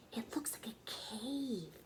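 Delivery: slow, hushed whisper of wonder; awed whisper. Acted. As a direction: breathe it out in awe, like peering into a cave. Sister awed looking in mouth.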